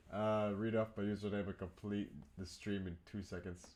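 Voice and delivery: normal talking voice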